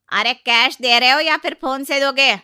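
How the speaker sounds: loud vendor butting in; impatient seller. Acted. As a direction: raise your voice over the squabbling. Impatient seller interrupts the quarrel.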